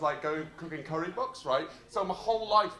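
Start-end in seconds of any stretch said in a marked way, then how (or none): none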